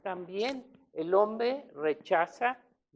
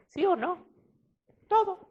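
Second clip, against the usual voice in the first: sharply higher pitched question and answer; rhetorical challenge; 11 semitones higher than usual. Real, not acted. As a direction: leap up high to press the crowd. Pregunta retórica a la congregación. (pregunta retórica muy aguda)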